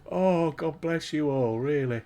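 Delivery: crying with laughter; laughing to tears. Real, not acted. Laughing at the chat, real tears of laughter. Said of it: "you've set me off now".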